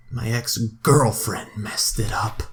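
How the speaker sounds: slow conspiratorial whisper. Real, not acted. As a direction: hiss the blame aside. Blaming ex in aside.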